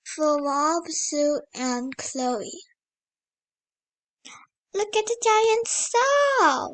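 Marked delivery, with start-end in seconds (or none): 4.7-6.7 excited. Real, not acted. Excited aside about picture.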